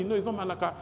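normal talking voice

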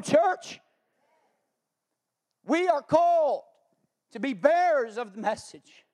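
loud, driving sermon delivery; full-force preaching. Real, not acted. Pastor rallying his church around its mission, full-force preaching. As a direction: preach it big, stretching the key words.

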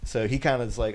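ordinary delivery

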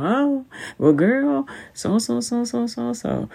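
high, airy so-so-so brush-off; a chatty friend who isn't really listening; breezy dismissive chatter. Retold or imitated. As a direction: wave the question away and move on to yourself. Self-absorbed friend brushing past you.

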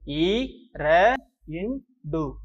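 இ, ர, இன், டு as separate drawn sounds; syllable by syllable. Shown as a demonstration. Teacher sounding out a number for children, syllable by syllable. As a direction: break இரண்டு into i, ra, in, du with gaps.

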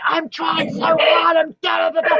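high, loud re-enacted outburst; exploding. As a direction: yell it in exasperation, retelling your own meltdown. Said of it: "I exploded in the car".

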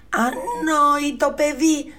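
high, loud, slow scolding cry; mother scolding. Acted. Mother shouting at Jack over the beans, high loud scolding. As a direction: shout at the foolish boy. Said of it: "φώναξε η μητέρα του".

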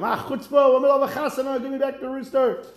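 high-pitched, outraged demand; indignant outburst. Acted. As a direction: demand it back in mock outrage.

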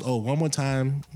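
ordinary talk

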